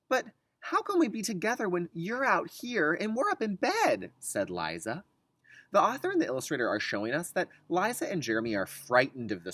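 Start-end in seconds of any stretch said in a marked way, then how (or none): 0.1-4.1 put-on higher, louder puzzled child. Liza questioning mom from bed, childlike voice. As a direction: ask like a small worried child.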